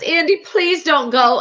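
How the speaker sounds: high, loud, drawn-out plea; mock pleading. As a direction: imitate begging someone to stay. Begging Andy not to leave.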